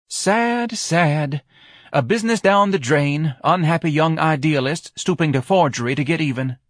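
high, loud, theatrically lamenting wife; high-pitched dramatic pity. A narrator's reading.

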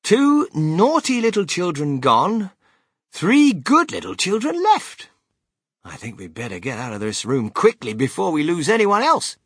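high, loud and animated storybook showman; Willy Wonka, theatrical. Acted.

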